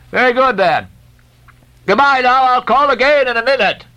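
high, loud cheerful parting call; loud farewell. A narrator's reading. Stubb calling goodbye to the French ship. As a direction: shout goodbye across.

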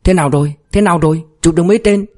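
eager questions, rising pitch; eager, urgent asking. A narrator's reading. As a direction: press excitedly for the news. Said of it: "mặt mày hớn hở lên xe hỏi gấp".

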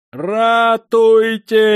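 very high, long-drawn howl; loud agonised wail; scream of pain. A narrator's reading. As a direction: scream for help, stretching every vowel. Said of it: "от жгучей боли завопил".

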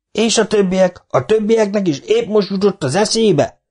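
high, exasperated yell. Acted. Foreman yelling at the old man, exasperated. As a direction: throw the question at him. Micu questions.

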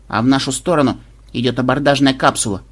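ordinary delivery, nothing marked

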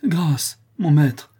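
high, loud begging cry with chattering teeth; boy pleading with his master near the monkey; terrified plea. Acted. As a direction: beg for mercy, shaking. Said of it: "criait-il en claquant ses deux mâchoires".

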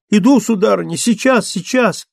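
startled high cry, half asleep; rudely awakened. A narrator's reading. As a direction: jolt awake and shout it out high. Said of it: "вскочила с просонья и закричала".

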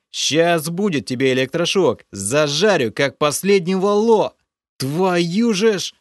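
loud, high furious outburst; furious rasp. A narrator's reading. Hero rages at the creature over the dead fish, furious. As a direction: spit it at him in rage.

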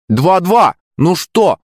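high-pitched crowing taunt; goading taunt. A narrator's reading. Friend goading rivals after equaliser, high teasing crow. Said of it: "начал их подначивать Мишка".

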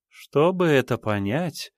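slow, sultry and teasing. Acted. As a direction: draw it out languidly. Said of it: "томным голосом произнесла Елизавета". (томно, протяжно)

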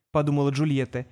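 ordinary delivery, nothing marked

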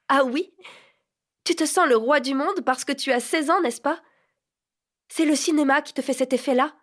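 moquerie aiguë, plus haute et plus forte; ironie blessée. A narrator's reading. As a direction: se moquer pour cacher les larmes. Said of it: "réussirent à rire". (ricanement ironique d'une fille humiliée)

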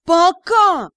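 high, loud indignant retort; extremely indignant. A narrator's reading. Captain answering the ghost's excuse, highly indignant. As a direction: throw the word back in outrage. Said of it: "Верис выглядела крайне возмущённой".

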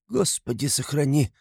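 high-pitched pious murmur; a woman's hushed exclamation to God; praying townswoman. A narrator's reading. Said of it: "проговорила одна женщина, крестясь". (женщина крестится, причитает высоким голосом)

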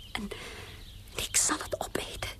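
soft unvoiced vow; whisper. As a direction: breathe the vow.

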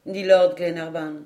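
usual speaking voice

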